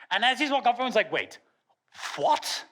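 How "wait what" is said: mock bewilderment; puzzled double take, played for laughs; baffled reaction. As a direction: act out a confused audience. Baffled audience acted out.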